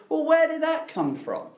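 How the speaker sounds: a sudden high cry of disbelief; baffled outburst. Retold or imitated. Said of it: "You go".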